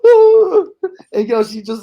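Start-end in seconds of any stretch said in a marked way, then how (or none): 0.0-0.7 high shrieking ooh; a loud frightened cry, retold; retold scream. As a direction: imitate her terrified shriek. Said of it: "And she goes".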